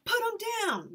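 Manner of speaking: high, loud demand; ordering. Acted. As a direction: command them to let him go. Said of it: "we ordered".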